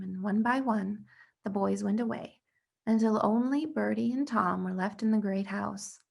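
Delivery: ordinary delivery, nothing marked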